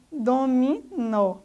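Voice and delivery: DOMINÓ slowly split, stress on final NÓ; última sílaba tônica. Shown as a demonstration. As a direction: say dominó syllable by syllable, landing on NÓ. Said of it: "é o nó". (dominó, devagar, com força no NÓ)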